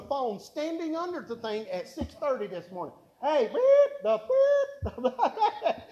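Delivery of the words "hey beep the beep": much higher and louder, yelling over a blaring alarm; shouting over alarm. Retold or imitated. The guard shouting down the phone under the alarm, laughing. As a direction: yell through the noise, breaking into a laugh.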